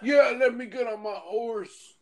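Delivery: put-on slow, high old-timer drawl; slow mock old-timer voice; mock old gamer. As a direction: play the old man proud of his horse. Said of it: "And you'll be like".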